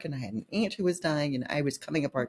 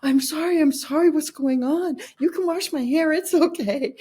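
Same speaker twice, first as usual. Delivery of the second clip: put-on high, alarmed child's plea, much higher and louder; frantic little girl apologizing; scared child begging mom; horrified child; 10 semitones higher, 3 dB louder than usual. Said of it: "she was horrified".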